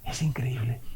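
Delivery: a hushed aside to the congregation; whisper. Real, not acted. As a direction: whisper it as if stunned. Whispered aside after the skit.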